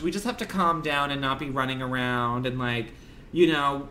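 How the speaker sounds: ordinary talk, nothing marked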